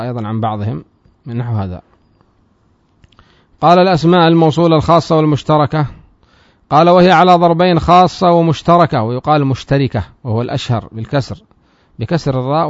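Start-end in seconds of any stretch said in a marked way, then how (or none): 3.6-5.9 raised, louder recitation of the source text; reading the text aloud. Shown as a demonstration. Teacher reciting the grammar text to students, raised voice. 6.7-9.0 raised, louder recitation of the source text; reading the text aloud. Shown as a demonstration. Teacher reciting the grammar text to students, raised voice.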